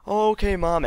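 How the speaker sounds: high, sing-song playing along; high put-on innocent child; feigned innocence. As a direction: answer mom as if you believe her. Child plays along, feigning belief.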